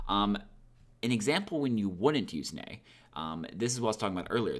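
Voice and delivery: ordinary delivery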